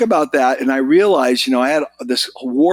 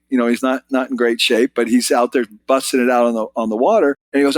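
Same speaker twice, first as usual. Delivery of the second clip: ordinary talk, nothing marked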